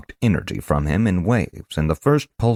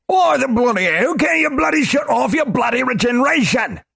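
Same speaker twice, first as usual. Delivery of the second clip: furious shout. Acted. Globin yelling at Korash, furious shout. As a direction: roar each bloody at him. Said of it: "ire manifested through volume".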